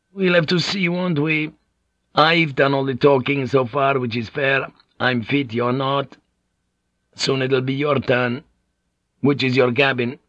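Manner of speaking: high, teasing foreign-sailor voice; canny Portuguese pilot. Acted. Pilot putting off Blackthorne's request to be taught, canny. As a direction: bargain with sly good humour.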